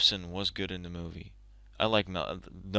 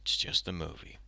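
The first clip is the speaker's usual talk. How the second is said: calming self; 5 semitones lower, 10 dB quieter than usual. Real, not acted. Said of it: "calm down".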